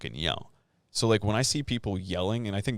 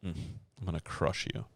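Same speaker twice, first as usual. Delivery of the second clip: quiet menacing threat; 3 semitones lower, 9 dB quieter than usual. Retold or imitated. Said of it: "just be like".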